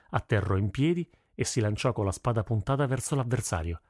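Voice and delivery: plain reading voice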